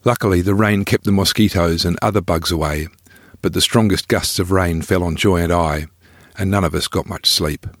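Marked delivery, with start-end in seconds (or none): none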